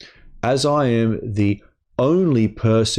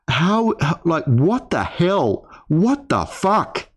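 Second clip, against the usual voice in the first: exasperated; 7 semitones higher than usual. Real, not acted. Exasperated venting at accuser.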